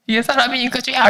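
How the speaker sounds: high-pitched imitated exasperation; mock indignant outburst. Evangelist griping to God about ungrateful person, mock indignant. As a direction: blurt it high, in comic outrage. Punchline of retold indignant prayer.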